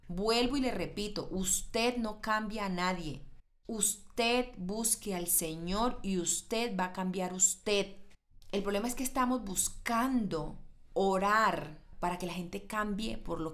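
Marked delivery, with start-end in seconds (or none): 1.3-8.0 slow, deliberate stress on usted; preacher hammering the point slowly; slow emphatic teaching. Real, not acted. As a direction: spell it out word by word.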